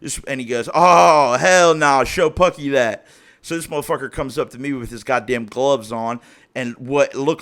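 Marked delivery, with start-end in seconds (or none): 0.7-3.1 loud, high "oh" easing down; imitated loud refusal. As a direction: burst out loud, mimicking his reaction. Acted reaction in a retold exchange.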